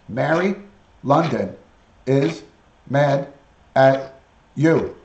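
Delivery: each word spaced and punched. Acted. Telling the parrot Mary London is mad, each word separate. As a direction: spell it out slowly to the bird.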